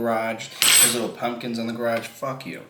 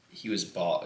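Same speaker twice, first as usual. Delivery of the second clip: normal talking voice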